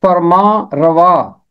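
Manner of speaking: फरमा रवा drawn out very slowly, syllable by syllable; teacher modelling फरमा रवा slowly; correct pronunciation. Shown as a demonstration. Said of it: "इसका दुरुस्त तलफ़ुज़ है".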